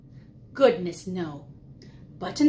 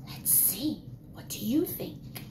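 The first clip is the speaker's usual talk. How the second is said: put-on hushed whisper to the kids; 6 semitones lower, 13 dB quieter than usual. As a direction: whisper it like a secret. Teacher whispering to children.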